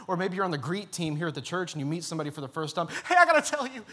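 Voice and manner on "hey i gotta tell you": much higher and louder, overeager; mock overeager greeting. Greeter unloading on a newcomer, mock overeager. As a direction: burst out at a stranger too eagerly. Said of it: "That's weird".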